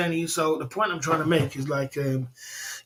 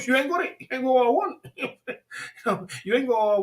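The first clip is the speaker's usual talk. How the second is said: much higher, laughing through the words; laughing taunt; 8 semitones higher than usual. Retold or imitated. Customer laughing at the chicken shop staff, much higher. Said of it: "They know if they say no".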